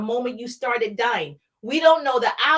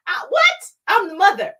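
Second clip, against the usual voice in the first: high, loud outraged protest; mock-offended jump up in pitch and volume; indignant mother; 7 semitones higher, 6 dB louder than usual. Indignant at being replaced as mother.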